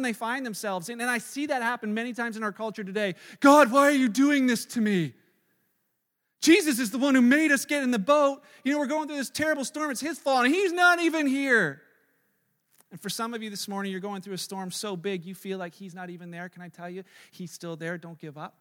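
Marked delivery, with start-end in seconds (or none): 3.4-11.8 loud, accusing complaint at God; mock angry blaming. Complaining believer shouts blame at God, loud and accusing. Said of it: "blaming Jesus for the storm". 16.5-18.6 soft, quiet comfort; gentle reassurance. Real, not acted. Pastor softly reassures struggling listeners, quiet and tender.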